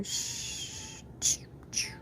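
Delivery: soft unvoiced shushing; hiding child shushing in a whisper. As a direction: hush yourself while hiding.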